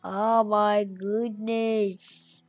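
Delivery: very slow, drawn-out moan; exasperated whine. Real, not acted. Gamer stuck two cats short, whining slowly. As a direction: drag every word out in weary exasperation.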